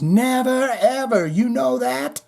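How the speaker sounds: lightly imitating a scream; mild mock scream. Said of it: "screaming from the top of my lungs".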